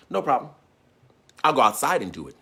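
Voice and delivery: normal talking voice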